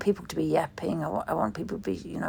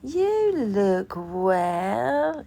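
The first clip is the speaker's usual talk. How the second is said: very drawn-out, louder mock compliment; mock visitor reassurance; 2 semitones higher, 7 dB louder, much slower than usual. Mocking hospital visitors to the dying, drawn-out false cheer. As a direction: exaggerate it slowly like a fake cheery visitor.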